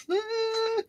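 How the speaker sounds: put-on high, small scared voice of a child under covers; mock fearful whisper. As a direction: squeak it out like a frightened kid hiding.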